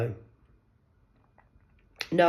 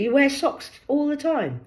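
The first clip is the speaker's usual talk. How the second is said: usual speaking voice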